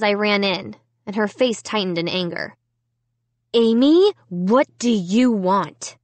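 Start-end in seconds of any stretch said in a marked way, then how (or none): 3.5-6.0 demanding. A narrator's reading. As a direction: snap out the name, then slow and cold. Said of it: "she demanded".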